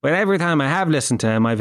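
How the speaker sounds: normal talking voice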